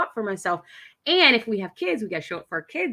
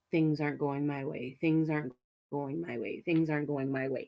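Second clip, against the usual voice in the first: echoing mantra; 6 semitones lower, 8 dB quieter than usual. Shown as a demonstration. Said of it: "It's an echo".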